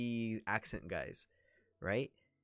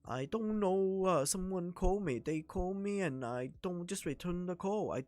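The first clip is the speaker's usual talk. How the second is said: higher-pitched Chinese accent; an unsure caller asking for help, Chinese accent; 8 semitones higher than usual. Shown as a demonstration. Demoing his Chinese caller, higher Chinese accent. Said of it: "the Chinese one".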